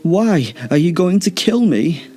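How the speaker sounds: high-pitched, overacted fright; mock fear. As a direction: pretend to be a scared victim, pitched high. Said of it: "gulped as though pretending to be scared".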